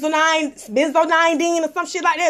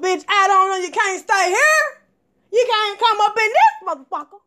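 firm dismissal; 6 semitones higher than usual. Retold or imitated. Putting her ex out of the house, firm and high. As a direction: send him packing, pitched up. Throwing the ex out.

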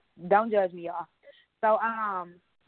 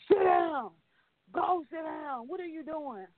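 husband annoyed; 6 semitones higher, 3 dB quieter than usual. Retold or imitated. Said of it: "my husband was pissed off".

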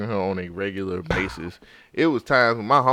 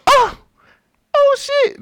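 high squeaky oh shit; mock surprised; 5 dB louder, slower than usual. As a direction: squeal it in shock. Teammates' reaction, mimicked.

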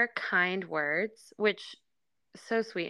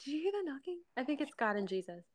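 put-on soft child voice; 6 semitones higher, 9 dB quieter, faster than usual. Retelling child's words, softly.

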